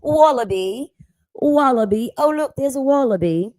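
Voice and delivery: put-on loud, high Australian accent; mock Australian accent. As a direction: play an excited Aussie spotting a wallaby. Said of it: "my accent probably fucking sucks".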